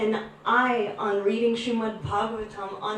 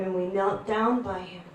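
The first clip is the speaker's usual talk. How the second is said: ordinary talk, nothing marked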